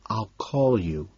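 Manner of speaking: soft, slow, nearly silent; mouthed. A narrator's reading. Lewis mouthing to Patrick across the room, near silent. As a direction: mouth it, barely any voice. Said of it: "He mouths".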